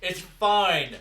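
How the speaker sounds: loud, high shouted reassurance; calling out. Acted. Player coaxes Terry inside, calling out loudly. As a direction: holler it to someone far off.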